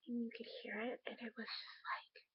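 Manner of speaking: awed whisper. Real, not acted. Hearing the baby's heartbeat.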